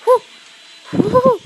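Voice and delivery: loud high whoop; cheering whoo. Real, not acted. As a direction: whoop with excitement. Cheering during game.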